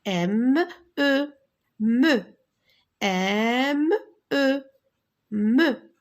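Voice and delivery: M, E, then blended into "me"; teacher modelling "me" with pauses; spelling aloud. Shown as a demonstration. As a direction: sound out M and E separately, then say "me".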